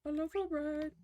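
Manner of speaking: Hugh Jackman impression. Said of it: "the Hugh Jackman impressions".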